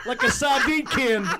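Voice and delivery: slow, laughing through the words. Real, not acted. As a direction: crack up mid-line. Laughing at own joke.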